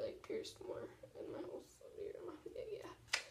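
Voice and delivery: whispered aside; whispering. Real, not acted. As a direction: drop to a whisper. Quick aside, unvoiced.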